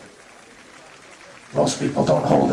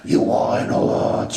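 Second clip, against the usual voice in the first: ordinary delivery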